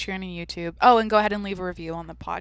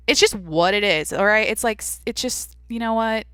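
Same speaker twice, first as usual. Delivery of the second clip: ordinary delivery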